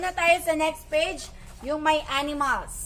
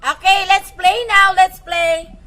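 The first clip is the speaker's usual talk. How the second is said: louder, eager call to start the game; excited call to play; 4 semitones higher, 9 dB louder than usual. Real, not acted. Teacher calls the class to start the game, excited.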